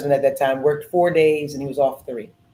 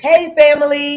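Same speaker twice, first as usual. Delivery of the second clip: high, loud cheery greeting; repeated mock hello, raised high; baby greeting; 10 semitones higher, 7 dB louder than usual. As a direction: call it out like a tiny adult. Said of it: "It's like".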